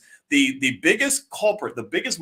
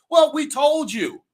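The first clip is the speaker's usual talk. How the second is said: louder and higher, slow and scolding; raised exasperated rebuke; told you so shout; 10 semitones higher, 5 dB louder, slower than usual. Real, not acted. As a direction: call them out with I-told-you-so heat.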